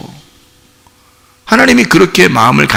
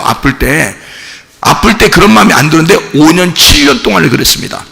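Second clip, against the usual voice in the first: usual speaking voice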